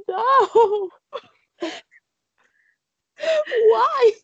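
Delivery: high, loud wail of despair, hammed up; mock sobbing. Fan needing comfort at the last film, mock sobbing. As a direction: act out wailing at a film, laughing after. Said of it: "I was sobbing".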